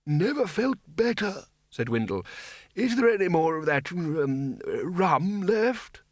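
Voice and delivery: put-on high, slow frail old wizard; reedy old man. A narrator's reading. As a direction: play a frail old man asking for rum. Old Windle voiced, high, far.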